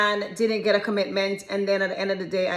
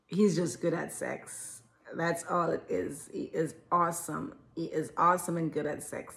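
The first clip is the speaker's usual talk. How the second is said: quiet reality check; 6 semitones lower, 10 dB quieter than usual. Real, not acted. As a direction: break it to them gently, low and measured. Deadpan reality check to the girls.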